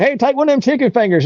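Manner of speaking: high excited wino voice; generous wino. Retold or imitated. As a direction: voice the drunk stranger high and excited. Wino offers food.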